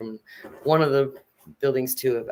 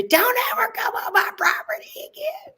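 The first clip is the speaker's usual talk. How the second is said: high screeching order off the porch; landlady screaming at the visitor to stay off her property. Retold or imitated. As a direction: shriek it as a banishment. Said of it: "She screamed at me".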